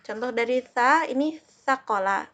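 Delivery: teacher demonstrating sa in sakola; letter sound demo. Shown as a demonstration. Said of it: "Itu contoh dari sa".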